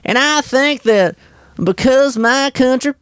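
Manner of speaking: high, slow hillbilly accent; put-on hillbilly Southern voice. Shown as a demonstration. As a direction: pitch it up into a hillbilly twang. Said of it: "what would the hillbilly kinda sound like".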